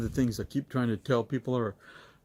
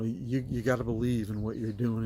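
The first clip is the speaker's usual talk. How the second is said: normal talking voice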